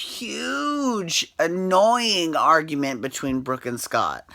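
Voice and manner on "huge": huge drawn out high and long, "huuuge"; stretched emphatic huge. Real, not acted. Complaining about the couple's fight, stretching huge.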